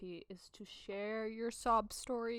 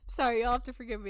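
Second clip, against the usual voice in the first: ordinary talk, nothing marked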